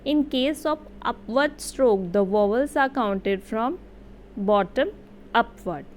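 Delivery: very slow, deliberate dictation pace; careful classroom reading of a rule; slow read-aloud. Shown as a demonstration. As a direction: read it out word by word, slowly. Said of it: "मैं read out कर देती हूँ".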